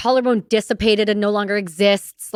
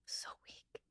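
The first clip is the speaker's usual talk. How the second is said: breathy whispered lament; 30 dB quieter than usual. Real, not acted. Admitting her weak shoulder to the host, whispered. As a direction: whisper it like a confession of weakness.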